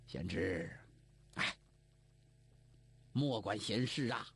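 put-on low, resigned old uncle; a touch of sorrow. A narrator's reading. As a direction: sad old uncle, mildly voiced. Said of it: "脸色有一分悲哀".

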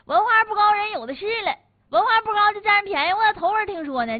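high, loud, scornful protest; indignant rebuttal. Real, not acted. Host scoffing at an excuse for taking advantage of people, indignant. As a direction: push it out high and hard in disbelief.

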